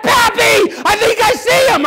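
put-on high, urgent child's cry; alarmed granddaughter. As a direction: mimic a grandchild calling out a sighting. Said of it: "She said".

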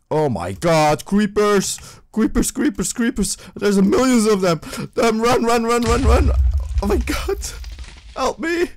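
high, loud panicked shouting as creepers swarm; panicked yelling. Real, not acted. Gamer chased by creepers, yelling in real panic. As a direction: scream for help, running for your life.

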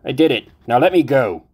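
high, loud panicked begging; terrified pleading. Acted. Confessed victim begging to be released, terrified loud plea. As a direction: plead in rising terror. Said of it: "her terror building".

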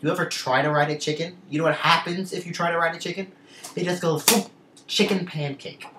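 high, loud mock-indignant case; impassioned argument. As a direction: argue it theatrically, building to the punchline. Acts out his passionate classroom argument.

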